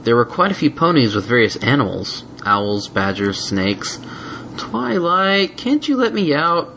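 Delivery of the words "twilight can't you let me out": whining. A narrator's reading. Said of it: "whined Spike".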